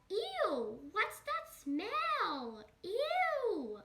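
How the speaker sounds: high, drawn-out mock disgust; slow, wailing ew of revulsion; hammed-up disgust. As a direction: squeal it as if hit by a stink. Acts out disgust at smelly feet.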